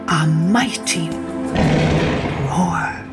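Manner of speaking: ordinary delivery, nothing marked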